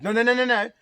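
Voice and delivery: high, loud string of no's; insistent objection. Retold or imitated. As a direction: cut in with rapid, emphatic refusals. Friend cuts her off insistently.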